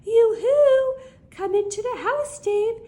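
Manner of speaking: high sing-song call across the house; high yoo-hoo calling voice. Acted. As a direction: call the boy in from the yard. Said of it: "she calls out".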